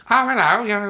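a high, loud, mock New Zealand "Oh, hello"; bad stab at a Kiwi accent. Fan imitating a New Zealand actress's entrance, mock New Zealand accent. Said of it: "I can't do a New Zealand accent".

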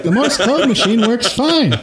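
high, breaking into laughter; laughing gnome excuse. Retold or imitated. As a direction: fake a gnome's cover story while cracking up. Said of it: "gnome is like".

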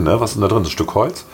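ordinary delivery

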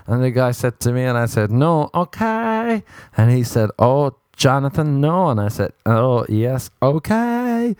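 coffee shop storyteller. Retold or imitated. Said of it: "this is how he tells the story".

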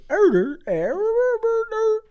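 high, slow creature gibberish; Grimace gibberish. Retold or imitated. Grimace giving his condition in slow high gibberish. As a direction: babble it like a cartoon mascot.

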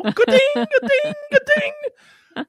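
very high-pitched ringing ka-dings; video-game coin dings. Pretend. Host being the coins in Mario, high mimicked chimes. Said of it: "Who's being the coins in Mario?".

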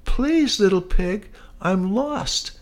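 very high pleading bug voice; ladybug voice. Acted. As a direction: beg like a tiny lost ladybug. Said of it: "said the ladybug".

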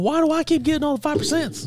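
high, whiny mock voice; mocking whiny beer snob. Teasing his friend in a high whiny voice. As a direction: squeak it out like a petty complainer. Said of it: "You're all".

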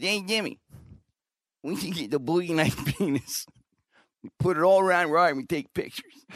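high-pitched buddy imitation; high put-on voice, pitching a prank; Kenny impression. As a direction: pitch it up like your scheming drinking pal. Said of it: "That's how Kenny talks".

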